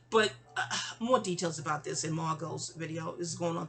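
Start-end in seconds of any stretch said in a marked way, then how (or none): none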